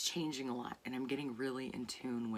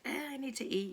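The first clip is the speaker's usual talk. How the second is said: high, grumpy mock whine; hangry Snickers ad; 11 semitones higher, 4 dB louder than usual. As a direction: play the cranky hungry guy from the ad. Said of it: "the Snickers commercials".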